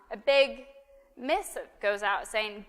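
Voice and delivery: ordinary delivery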